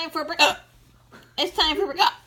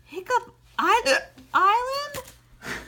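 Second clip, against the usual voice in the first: rising question; 9 semitones higher, slower than usual. Acted. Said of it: "No, no. Not Hiccup".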